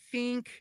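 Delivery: "think" with a long ee vowel, like "theenk"; E pronunciation. Shown as a demonstration. Showing listeners the SoCal pop punk vowel, "think" said as "theenk". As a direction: say "think" high-pitched, its vowel stretched toward ee. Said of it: "this E pronunciation".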